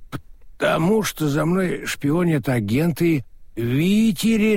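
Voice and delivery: put-on drunk, words sliding together; slurring drunk. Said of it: "заплетающимся языком".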